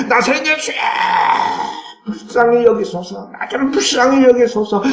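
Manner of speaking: high desperate shout for mercy; crying out. Acted. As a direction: cry out to Jesus as Bartimaeus. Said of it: "표출하는 거예요".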